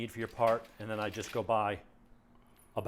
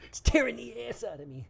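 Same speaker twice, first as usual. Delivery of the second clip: high, strained mock yell; Jerky Boys impression; 10 semitones higher than usual. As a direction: whine it like a prank caller. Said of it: "[name]".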